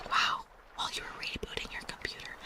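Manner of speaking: ordinary delivery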